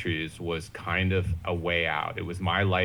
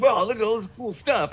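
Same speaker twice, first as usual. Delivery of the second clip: much higher, mock-wonder voice; acted kid excitement; 14 semitones higher than usual. Scavenger kids pick through burnt salvage, mock excited. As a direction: marvel like a kid finding treasure.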